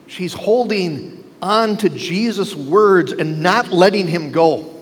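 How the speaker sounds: usual speaking voice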